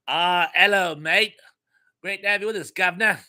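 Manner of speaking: hammed-up English "mate and guv'nor" accent; bad English accent. Host welcoming an English viewer, bad English accent. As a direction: greet them in a deliberately bad English accent. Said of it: "worst English accent ever".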